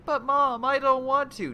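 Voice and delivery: high, loud mock kid whine; hammed-up child refusing homework; whiny child. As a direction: whine back like a stubborn five-year-old. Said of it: "telling a five-year-old".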